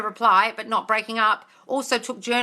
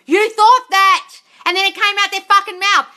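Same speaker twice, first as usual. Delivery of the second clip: much higher, louder scolding yell; 9 semitones higher, 8 dB louder than usual. Real, not acted. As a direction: lay into her at full volume. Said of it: "for yelling at you".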